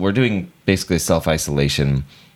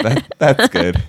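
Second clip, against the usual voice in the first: laughing; 8 semitones higher, 4 dB louder, slower than usual. Real, not acted. Laughing at shared naming habit.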